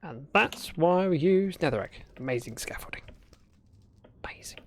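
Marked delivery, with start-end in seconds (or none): 2.2-4.6 softly whispered sarcastic praise. Real, not acted. Streamer whispering mock praise of own build. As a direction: whisper it with dry mock admiration.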